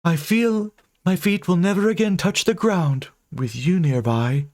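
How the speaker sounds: high, doting specter voice; booming ghost. Acted. Ghost answers her beloved dreamily. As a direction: coo it dreamily.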